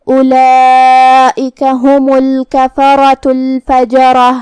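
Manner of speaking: slow melodic tajweed chant; Quran recitation. Shown as a demonstration. Teacher recites the verse in tajweed style. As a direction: draw the verse out in measured recitation.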